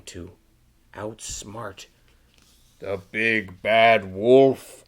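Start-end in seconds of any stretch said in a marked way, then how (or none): 2.8-4.8 slow, loud, growly wolf line; big bad wolf. A narrator's reading. Reading a picture book aloud.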